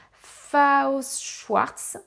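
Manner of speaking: dire «Faust Schwarz» très lentement, en détachant chaque syllabe; prononciation appliquée. Shown as a demonstration. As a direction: articuler «Faust Schwarz» avec concentration, en ralentissant beaucoup. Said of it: "Attention, je me concentre". («Faust Schwarz» prononcé lentement et avec application, comme un nom anglais difficile)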